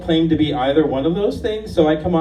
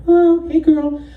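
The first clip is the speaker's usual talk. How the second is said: much higher, louder campy hello; 14 semitones higher, 6 dB louder than usual. Retold or imitated. Sassy caller greeting a friend, in a loud high falsetto. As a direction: shriek it like a sassy queen.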